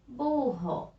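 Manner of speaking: "Burro" sounded out with an h-like RR; teacher modelling "Burro" with a breathed R; strong double R. Shown as a demonstration. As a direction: say "Burro" as bu-ho, clearly separated. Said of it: "Pronúncia forte".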